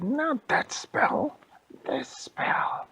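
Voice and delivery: very high, loud, drawn-out correction; hammed-up spellcaster frustration; exasperated witch. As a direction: squawk it, then rasp the retry. Witch more frustrated at failed spell.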